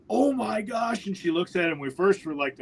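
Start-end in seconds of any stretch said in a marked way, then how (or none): 0.1-1.1 high-pitched imitation of an amazed woman. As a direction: gasp it out high, mimicking her amazement. Woman reacting to dead bear, retold.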